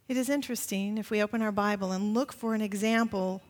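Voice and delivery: usual speaking voice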